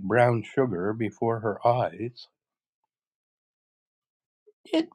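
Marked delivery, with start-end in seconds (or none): 4.6-4.9 high wail climbing higher, halting; wailing, despairing witch. Acted. The witch asks Dorothy about the water, despairing wail. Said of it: "in a wailing, despairing voice".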